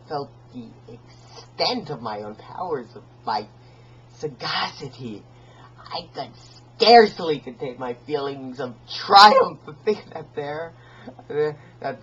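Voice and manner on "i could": breathless whisper; whispered aside. Acted. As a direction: hush it like a secret. Hushed confession of triumph.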